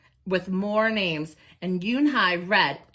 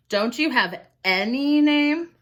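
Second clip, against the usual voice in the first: ANY drawn out and raised in a slow question; stress on ANY; 6 semitones higher, 5 dB louder, slower than usual. Shown as a demonstration. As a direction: lean hard and long on "any". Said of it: "italics mean to put an emphasis on a word".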